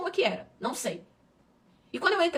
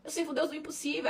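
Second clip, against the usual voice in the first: normal talking voice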